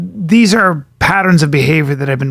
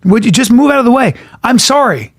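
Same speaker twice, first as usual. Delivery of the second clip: high, fast, dismissive casting voice; mock casting director; 6 semitones higher than usual. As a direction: brush them off like a busy casting agent. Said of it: "It's like this".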